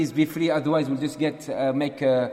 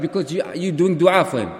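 ordinary talk, nothing marked

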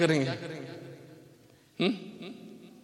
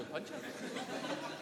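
breathy laugh; 8 semitones higher, 16 dB quieter than usual. Real, not acted. Laughs at the answer.

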